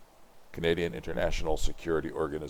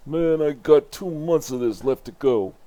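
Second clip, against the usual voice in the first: griping lonely guard, raised pitch; bored guard; 8 semitones higher, 4 dB louder than usual. Acted. Bored outpost guard griping to himself, higher voice.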